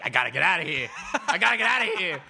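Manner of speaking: desperate escapee. Pretend. Parakeet plotting escape like a movie fish, mock-desperate. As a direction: play a bird frantic to break out.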